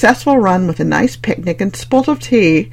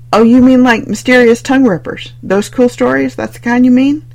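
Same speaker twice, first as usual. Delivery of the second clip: sarcastic teasing; 6 semitones higher, 5 dB louder than usual. Acted.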